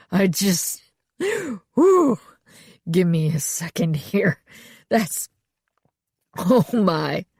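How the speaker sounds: catching breath from laughing; between laughs. A narrator's reading. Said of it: "he said between laughs".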